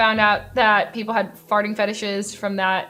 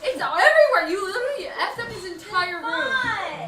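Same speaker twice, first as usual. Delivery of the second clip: mock terrified shriek; 11 semitones higher than usual. Real, not acted. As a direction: shriek in playful alarm. Reacting to a snowed-in room.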